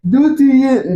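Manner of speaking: imitating a high African accent; quick, high-pitched mock of an African accent. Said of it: "because she was African".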